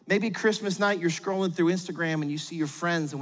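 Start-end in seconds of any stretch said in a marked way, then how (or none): none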